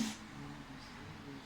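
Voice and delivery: short voiceless whoosh; soft breathy arrow-flight noise; imitated arrow shot. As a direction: puff out an arrow's release. Mimes an arrow being shot.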